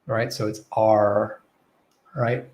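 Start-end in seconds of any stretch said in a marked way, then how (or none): 0.7-1.4 R held long as AR; isolated, clearly sounded R; enunciated American R. Shown as a demonstration. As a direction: draw out the R. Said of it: "they'll enunciate that R".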